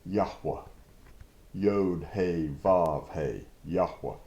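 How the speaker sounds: Yahweh said YAH-weh, then spelled Yod He Vav He; Yahweh, YAH-weh, letter by letter; pronouncing the name. Shown as a demonstration. Scholar's pronunciation taught to listeners, careful YAH-weh. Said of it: "had the pronunciation".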